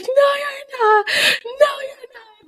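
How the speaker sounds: very high, drawn-out imitation wail; hammed-up high, slow denial; mock disbelief. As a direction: squeal slowly as a stunned mom. Mom's disbelief at the pregnancy news.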